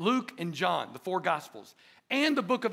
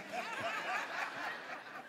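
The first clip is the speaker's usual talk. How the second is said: breathy laugh; 13 dB quieter than usual. Real, not acted. Laughing at own tease.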